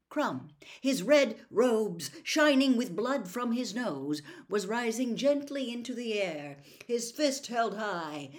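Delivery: plain reading voice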